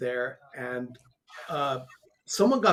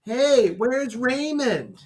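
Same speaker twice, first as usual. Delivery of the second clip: drawn-out, higher-pitched holler; mom yelling out; 7 semitones higher, 4 dB louder, much slower than usual. Retold or imitated. Mom calls out to a celebrity in line, yelling. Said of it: "yells out".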